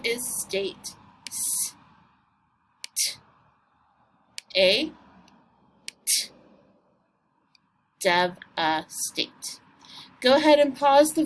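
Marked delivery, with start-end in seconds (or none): 1.3-6.3 s, t, long a, t as separate sounds; isolated phonics sounds s, t, a, t; sounding out. Shown as a demonstration. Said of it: "Remember to sound this out". 8.0-9.6 DEVASTATE slowly as dev, a, state; three separated beats on devastate, v closing the first; split into syllables. Shown as a demonstration. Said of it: "let's do our syllables".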